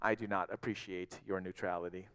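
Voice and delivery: low, soft grumble under the breath; subdued, resigned aside; almost to himself. A narrator's reading. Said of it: "almost to himself this time".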